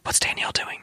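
soft conspiratorial whisper. Pretend. Host checks on the producer's reaction, whispering. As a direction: whisper it as a sly aside. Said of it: "why we're whispering".

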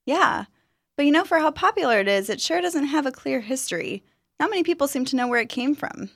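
eager and curious; young woman. Acted. Student muses on history.

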